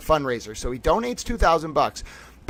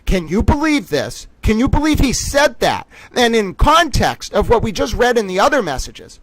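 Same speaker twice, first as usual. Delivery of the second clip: higher, louder indignant disbelief; frustrated outrage; 7 semitones higher, 5 dB louder than usual. Real, not acted. To the audience about the post, indignant and loud. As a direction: demand the audience share the outrage. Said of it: "I know I'm getting frustrated".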